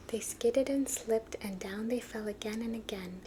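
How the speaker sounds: ordinary delivery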